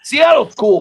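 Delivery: high, loud emphatic backtrack. Real, not acted. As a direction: blurt it out to take it back. Loud correction after gum wall rant.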